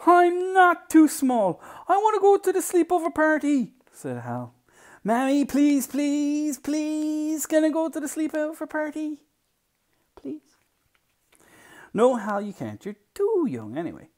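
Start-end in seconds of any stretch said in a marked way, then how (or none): 0.1-3.7 put-on high, loud little boy's tantrum; protesting child. Child protests being too small. 5.0-10.6 high, insistent begging, loud until a small last please; pleading child. Acted. Child begs his mother. 11.9-14.1 louder, higher, emphatic no; firm mother's refusal. Acted.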